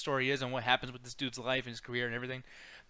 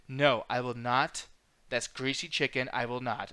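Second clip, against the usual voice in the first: slow, lower, prim mock refusal; overdone refusal; 5 semitones lower than usual. Imitating the actor's overdone refusal, lower and slow. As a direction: imitate an overacted stiff refusal. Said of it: "overly does this, like".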